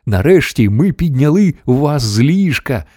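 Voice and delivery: ordinary delivery, nothing marked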